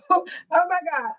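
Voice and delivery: gasping through helpless laughter; laughing so hard it turns to crying. Real, not acted. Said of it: "I can't breathe".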